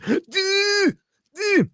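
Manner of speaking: high dopey duh; mock the viewers as dim; silly high-pitched duh. Mocking chat viewers.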